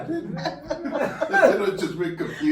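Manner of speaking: high laughing voice. Real, not acted. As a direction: laugh through the words. Son laughing at imagined confusion.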